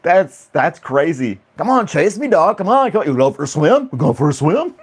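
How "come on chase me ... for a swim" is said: high, excited coaxing of a dog; mock come-and-get-me kangaroo voice; taunting kangaroo. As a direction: egg the dog on playfully, pitched up. Mimics kangaroo luring a dog.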